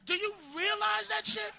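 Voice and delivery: normal talking voice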